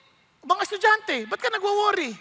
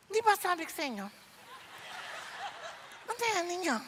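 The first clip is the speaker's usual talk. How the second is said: hushed scolding; 13 dB quieter than usual. Retold or imitated. Hostess hushes helpers before pastor.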